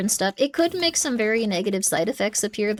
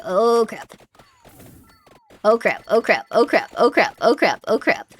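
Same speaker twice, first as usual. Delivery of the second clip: terrified gibbering; 5 dB louder than usual. Real, not acted. Panicking in game, repeated exclamations.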